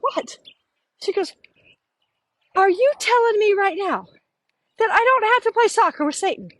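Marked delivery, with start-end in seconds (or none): 0.0-0.4 surprised what. Retold or imitated. Incredulous reaction in retold dialogue. 2.6-6.5 high incredulous disbelief; high voice of stunned relief; astonished question. Retold or imitated. Friend's astonished question, retold.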